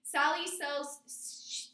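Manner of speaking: slow, careful tongue twister, stumbling once; the teacher tripping over a tongue twister, slowly. Shown as a demonstration. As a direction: pick through each s sound slowly. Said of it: "Miss Norton struggled a little bit with saying".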